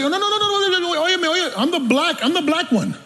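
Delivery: high, rapid mock protest; acted protest. Preacher acting out a protest of who he is, high insistent protest.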